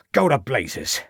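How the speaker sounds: a touch of defiance, dismissive; defiant. A narrator's reading. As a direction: shrug him off, unbowed. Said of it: "replied Troutbridge defiantly".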